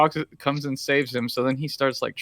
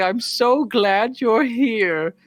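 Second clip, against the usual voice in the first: slow, stretched, sugary voice; hammed-up heartfelt; mock sappy sincerity; 8 semitones higher, 3 dB louder, much slower than usual. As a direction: drag it out like a sentimental film line. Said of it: "but instead I'll just say".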